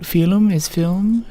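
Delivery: slow Irish film said filum, two syllables. Shown as a demonstration. Irish streamer savouring film as filum in chat. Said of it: "having too much fun with".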